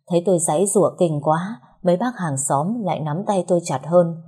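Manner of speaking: usual speaking voice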